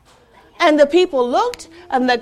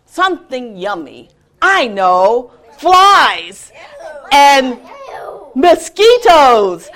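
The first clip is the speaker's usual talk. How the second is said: louder, laughing delight; gleeful frog; 9 dB louder, slower than usual. Acted. Frog father delighting in flies, gleeful. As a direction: relish the thought of tasty bugs.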